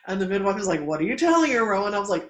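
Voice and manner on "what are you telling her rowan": higher, louder demanding question; retold challenge. Midwife questioning what the doula told the patient, challenging. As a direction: call her out, imitating the midwife.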